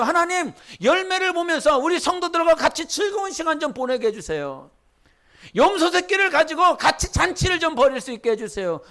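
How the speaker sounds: ordinary delivery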